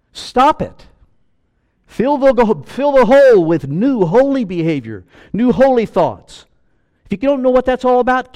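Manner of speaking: loud, high, urgent pulpit cry; preaching at full force. Acted. Preacher telling sinners to stop, full-force preaching. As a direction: drive the command home to the listeners.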